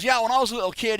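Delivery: high, loud mock Australian; bad Crocodile Dundee accent. [name] begins his childhood story, bad Australian accent. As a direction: start the tale in badly faked Aussie. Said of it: "this is my very bad Crocodile Dundee impression".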